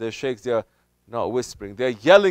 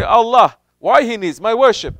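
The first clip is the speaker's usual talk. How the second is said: raised, louder whiny doubter voice; mock doubter; 8 semitones higher, 9 dB louder than usual. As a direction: play the questioning doubter, pitched up. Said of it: "Pretty soon you're gonna say".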